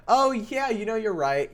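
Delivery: high, loud dawning realization; excited agreement. Real, not acted. Streamer conceding the chat is right, excited realization. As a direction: start high and loud, settling as it lands.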